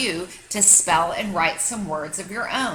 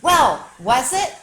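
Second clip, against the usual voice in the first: eager demand; 5 semitones higher, 7 dB louder, slower than usual. A narrator's reading. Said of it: "rushing over to the tree".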